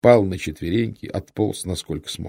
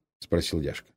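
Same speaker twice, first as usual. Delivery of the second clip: ordinary delivery, nothing marked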